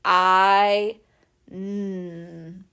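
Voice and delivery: "I" then "n" stretched apart; sounding out. Shown as a demonstration. Teacher to kids, sounding out shine. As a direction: segment "I, n" slowly. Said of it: "break that down into the sounds".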